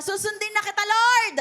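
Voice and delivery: loud, rising cry of surrender; shouted vow. Acted. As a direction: shout the promise to the Lord. Leading congregation commitment prayer.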